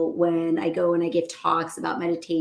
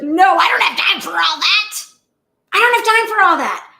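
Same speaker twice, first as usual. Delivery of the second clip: high, loud, exasperated patient; upset refusal; 11 semitones higher, 9 dB louder than usual. Retold or imitated. Stressed patient tells her doctor she has no time, loud and high. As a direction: brush it off, overwhelmed and loud.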